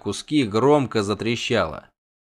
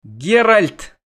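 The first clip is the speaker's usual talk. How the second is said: high, loud cry of his name; sudden shout; 9 semitones higher, 6 dB louder than usual. Acted. Yennefer cries out to Geralt, high and loud. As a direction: call him sharply. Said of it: "вдруг крикнула Йеннифер".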